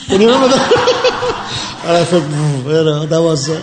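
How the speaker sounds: laughing through the words, pitch jumping up; can't stop laughing while asking. Real, not acted. Laughing as he reminds Hedi of her joke, laughing. Recalling a funny moment with Hedi.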